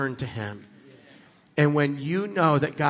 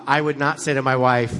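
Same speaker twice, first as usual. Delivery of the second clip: ordinary talk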